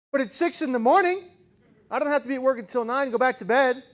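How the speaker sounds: mock-grumpy father telling his son to go back to bed; groggy dad. Dad woken at six, grumbling.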